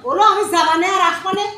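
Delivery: loud call from across the house; calling back loudly. Acted. Wife calling back loudly to her husband while leaving. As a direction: shout it back as you leave.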